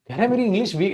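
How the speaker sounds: usual speaking voice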